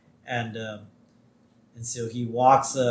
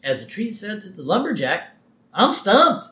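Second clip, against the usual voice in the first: pun delivered with a high lift at the end; cartoon quip; 6 semitones higher than usual. Host retelling a cartoon pun, quipping. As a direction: deliver the punchline with a rising, cheesy lilt.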